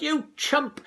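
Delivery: high yelled chump; mother yelling at her son; yelled insult. A narrator's reading. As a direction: spit it out as a yell. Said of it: "she yelled".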